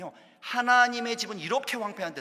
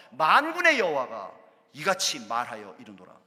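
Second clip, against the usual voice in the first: solemn declaration; proclamation; 5 semitones lower, 7 dB louder than usual. Acted. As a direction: announce the Lord's words. Reading God's proclamation.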